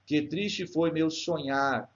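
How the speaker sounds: slow, measured line of poetry; verse recitation. Shown as a demonstration. Teacher reading the next verse to students, slow recitation. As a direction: recite it slowly, syllable by syllable. Reads the poem's verse aloud.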